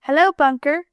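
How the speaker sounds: put-on lively little-girl greeting; cried greeting. A narrator's reading. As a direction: call out happily to a friend driving up. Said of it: "cried Sue".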